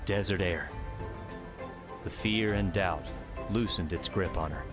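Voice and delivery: ordinary delivery, nothing marked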